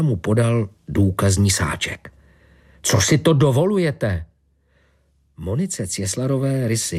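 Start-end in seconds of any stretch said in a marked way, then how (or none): 2.8-4.3 higher-pitched affronted question; indignant protest. A narrator's reading. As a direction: object as if outraged, pitch raised. Suspect protests at scissors seized. (pobouřená námitka vyšším hlasem)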